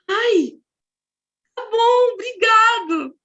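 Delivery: high, loud, drawn-out gratitude; overwhelmed thanks. Retold or imitated. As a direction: act out someone touched and thrilled. Acts out the waiter's touched reaction.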